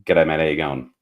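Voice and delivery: "G'day, mate. How you going?" run together as one word; "G'day, mate. How you going?" with the consonants left out; speak Australian. Shown as a demonstration. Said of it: "that's actually one word".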